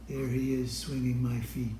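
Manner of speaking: ordinary delivery, nothing marked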